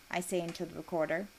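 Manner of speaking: ordinary delivery, nothing marked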